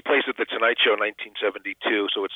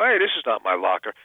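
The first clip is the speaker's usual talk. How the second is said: throwaway line. Retold or imitated. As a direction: toss it off casually. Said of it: "It was a little throwaway line".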